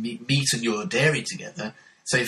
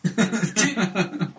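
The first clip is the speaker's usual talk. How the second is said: high burst of laughter; 8 semitones higher than usual. Real, not acted. Laughing at the Yellow Pages joke, high laugh.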